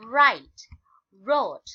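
"write", "wrote", each opening on a strong R; silent W drill. Shown as a demonstration. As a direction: model "write" and "wrote" loudly, starting from R. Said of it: "ออกเสียงตั้งแต่ตัว R เป็นต้น". (อ่านนำ "write", "wrote" เสียงดัง เริ่มที่เสียง R)

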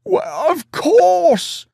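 very high, overjoyed cry; falter, then burst out loud and drawn out; stammer into outburst. A narrator's reading. Giant forgives his returned king, stammer becoming joyful shout. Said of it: "stammered the giant".

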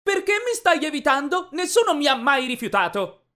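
high, loud indignant shout; yelling. A narrator's reading. Girl confronting the boy who avoids her, high-pitched yell. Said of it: "si avvicinò a me e urlò".

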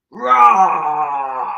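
big playful lion roar. Shown as a demonstration. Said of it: "let's roar like lions".

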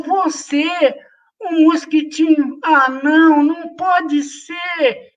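can't believe it, little bunny; doubtful bunny. Acted. Bunny doubting the tiny mosquito, high disbelief.

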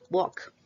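Walk without L, like wok; silent L. Shown as a demonstration. Teacher repeats for students, L silent in Walk. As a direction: leave the L out of Walk. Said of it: "Sin la L, así".

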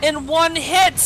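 angry rant. Real, not acted. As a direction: rant in real fury. Rage rant.

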